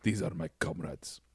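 low, slow Russian accent. Shown as a demonstration. Scary communist introducing comrades, Russian accent. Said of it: "say it with a Russian accent".

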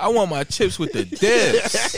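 drawn out and proud, mock [name]; [name] impression. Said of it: "He said".

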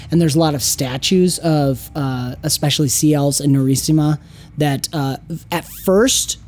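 composed storyteller at the table; game master narration. Acted. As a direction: lay out the mystery evenly. Game master describes the temple.

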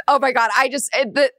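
normal talking voice

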